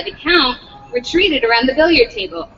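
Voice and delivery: ordinary delivery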